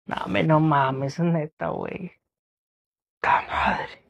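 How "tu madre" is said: whisper. Acted. Whispered exclamation in skit.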